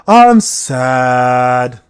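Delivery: low, drawn-out hammed-up misery; mock sad person. Streamer imitating a sad person to tease a chatter, mock mournful. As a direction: moan it long and loud like a gloomy sad sack. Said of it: "Only a sad person will say it that way".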